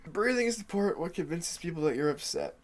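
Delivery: normal talking voice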